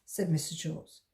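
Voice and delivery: ordinary delivery, nothing marked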